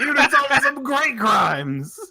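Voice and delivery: high, slow cartoon detective; squeaky character voice; Detective Pikachu voice. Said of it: "Detective Pikachu here".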